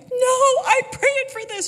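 high, loud, gleeful exclamation; joyful reply. Retold or imitated. Mother telling her apologizing daughter, gleeful high exclaim. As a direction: burst out with delight.

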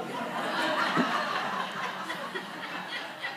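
breathless high laughter. Real, not acted. Laughing at the story, high breathless laughter. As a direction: laugh hard.

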